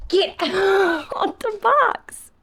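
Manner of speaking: high, slow, laughing "get out of the box"; laughing scold. Real, not acted. Laughing at the pet climbing in the box, high and slow.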